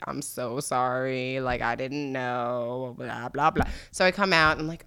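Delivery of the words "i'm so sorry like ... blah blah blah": slow, soft mock apology; insincere, softly droned excuse; rehearsed apology. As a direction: drag out a put-on sorry.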